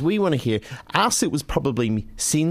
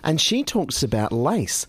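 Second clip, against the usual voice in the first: ordinary delivery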